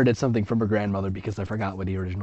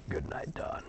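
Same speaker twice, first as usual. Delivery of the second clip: soft bedtime whisper; soft whispered goodnight; 7 semitones lower, 14 dB quieter than usual. Acted. Twin saying goodnight to her sister, whispered. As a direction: whisper it as lights go out.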